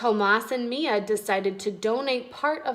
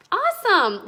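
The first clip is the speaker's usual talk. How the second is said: excited; 9 semitones higher, 5 dB louder than usual. Real, not acted. Teacher cheers after story ends.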